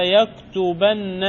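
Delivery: يكتبن very slowly as yaktubanna, nn held; doubled final nun. Shown as a demonstration. Teacher showing the emphatic nun, يكتبن as yaktu-banna. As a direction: stretch يكتبن with an open b and double n. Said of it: "مشددة".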